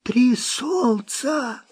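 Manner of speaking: slow, high hoarse muttering. A narrator's reading. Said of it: "хрипло пробормотал Маккен".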